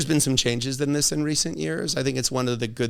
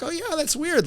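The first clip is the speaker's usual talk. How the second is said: casual interest; 3 dB quieter than usual. Retold or imitated. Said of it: "And they'd be like".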